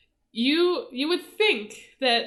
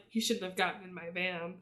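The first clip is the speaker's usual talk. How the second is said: softer, totally deadpan delivery; 4 semitones lower, 9 dB quieter than usual. Retold or imitated. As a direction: say it with no expression at all. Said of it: "Like, just deadpan".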